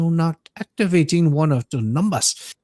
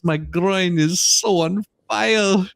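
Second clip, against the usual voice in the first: mock pleading; 4 semitones higher, slower than usual.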